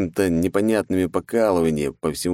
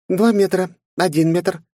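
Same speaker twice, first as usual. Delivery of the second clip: higher and louder countdown call; exclaiming; 12 semitones higher, 4 dB louder, slower than usual. Acted. Mai exclaims as the laser drills through. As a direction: call the stop sharply. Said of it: "воскликнула Май".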